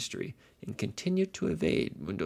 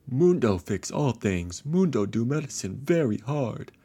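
brute monster voice; 5 semitones higher, 9 dB louder than usual. Acted. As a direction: pound out each word like a brute. Recited vows of the monster doctor.

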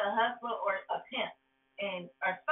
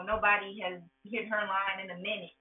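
ordinary talk

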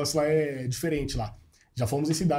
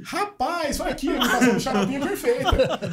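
puppet voice, much higher and laughing; Xaropinho impression; 9 semitones higher, 4 dB louder than usual. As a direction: squeak it out like the TV puppet. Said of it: "Aí eu vim imitar o Xaropinho". (imitação do boneco, bem aguda e rindo)

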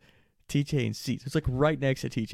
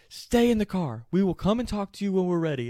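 high, raised-voice police order; stern command; 9 semitones higher, 4 dB louder than usual. Retold or imitated. Cops ordering pleading buddy to stay put, commanding. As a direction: imitate a cop shutting someone down.

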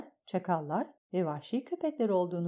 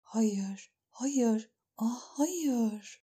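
rising to higher, horrified no; trembling denial; 4 semitones higher, much slower than usual. A narrator's reading. Dany seeing the burned bones, higher horrified. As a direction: repeat it as the dread grows. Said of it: "Dany titredi".

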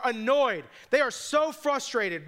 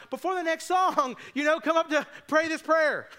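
higher, urgent plea while being hauled away; preaching while dragged out; 6 semitones higher than usual. Retold or imitated. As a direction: keep inviting as they drag you off. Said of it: "I'm like".

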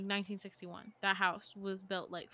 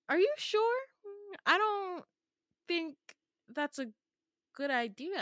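doubtful; 9 semitones higher, 3 dB louder than usual. Retold or imitated. Doubting his choice.

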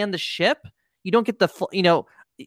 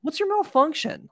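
higher incredulous mock demand; 10 semitones higher than usual. Mock-accusing Uncle Gobi.

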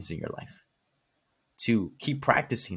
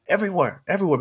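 "Everywhere" hit much higher and louder, twice; emphatic everywhere; 9 semitones higher, 6 dB louder, faster than usual. Real, not acted. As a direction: punch "Everywhere" both times. Emphatic complaint about angry people.

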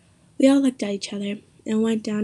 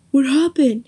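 louder yell of the question; 4 semitones higher, 6 dB louder than usual. A narrator's reading. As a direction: yell it out in alarm. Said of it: "he yelled".

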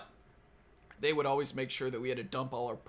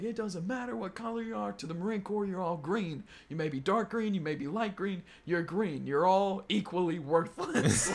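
higher-pitched mock speech to recruits; drill instructor lecture; 6 semitones higher, 4 dB louder than usual. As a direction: imitate an instructor laying down the law.

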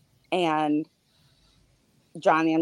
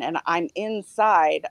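normal talking voice

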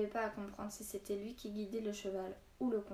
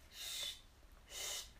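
soft whispered shush; hushed, drawn-out shushing; hushing; 9 dB quieter than usual. A narrator's reading. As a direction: shush gently, all breath and no voice. Hushing horse and friends.

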